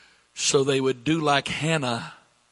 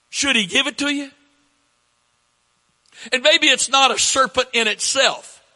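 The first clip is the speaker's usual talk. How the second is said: higher, louder preacher's voice; forceful preaching; 8 semitones higher, 6 dB louder than usual. Real, not acted. Preacher paraphrasing scripture for the congregation, forceful preaching. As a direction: press the point to the congregation, loud and high.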